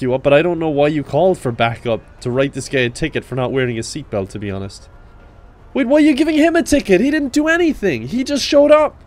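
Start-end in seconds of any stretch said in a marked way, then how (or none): 5.7-9.0 high, fast incredulous rant; high baffled protest. Real, not acted. Cop baffled his partner tickets an innocent. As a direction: blurt out the confusion quickly.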